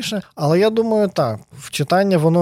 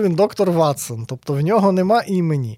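ordinary talk, nothing marked

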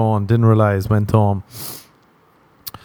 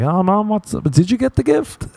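much higher, sweet innocent voice; sneaky son on the phone; 12 semitones higher than usual. Pretend. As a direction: play innocent to mom, pitched way up. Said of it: "phone my mom tomorrow and be like".

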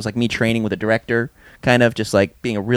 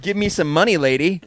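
high, sassy mock child demand; hammed-up bratty kid mouthing off; kid attitude; 6 semitones higher than usual. Boy at a casting call sassing the agent: high mock attitude. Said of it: "I gave her more attitude".

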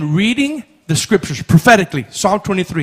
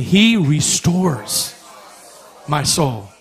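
leading congregation; 3 semitones lower, much slower than usual. Shown as a demonstration. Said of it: "everybody say this with me".

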